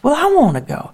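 put-on high, loud little girl insisting; a small girl demanding to go too; insistent child. Daughter insists to her mother that she go too, high whiny child.

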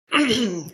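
a quick rough clearing of the throat. Real, not acted. Choked-up son telling of his dad's death, clears throat. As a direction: clear the throat before going on. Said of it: "Gotta clear me throat".

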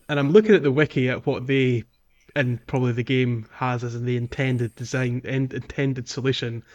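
usual speaking voice

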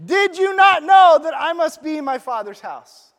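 put-on boy answering his parents, loudly; indignant shout. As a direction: fire the question back. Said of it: "So Jesus turns it to this".

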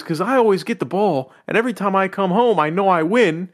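high, giddy dog voice; Will Ferrell's dog voice. Retold or imitated. Will Ferrell's dog gushing about fetch, high giddy voice. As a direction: bounce it up high like a happy dog. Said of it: "He goes".

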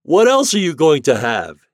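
high, loud captain's question; hearty long-distance holler; called out. A narrator's reading. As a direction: call it across the river. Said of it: "he called".

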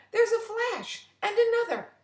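high, loud and thrilled; delighted. Acted. Frida watches the light show, thrilled. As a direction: marvel at every flash.

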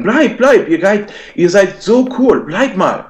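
high mock pleading voice; begging trolls. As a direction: beg in a squeaky high voice, mocking them. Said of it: "ein paar neue Typen sagen". (Trolle im Chat betteln, hohe spöttische Flehstimme)

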